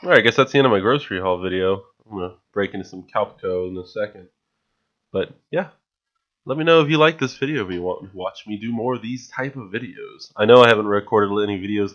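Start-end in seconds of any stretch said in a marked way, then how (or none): none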